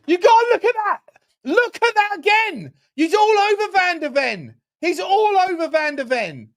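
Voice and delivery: high, loud outraged appeal; outraged protest. Real, not acted. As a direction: protest furiously at the call.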